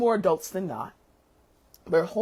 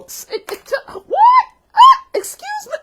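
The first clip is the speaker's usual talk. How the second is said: stunned stammer bursting into a high, loud what, excuse me; sputter, then shriek your disbelief; outraged disbelief; 13 dB louder than usual. Pretend. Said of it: "this I'm doing for emphasis sake".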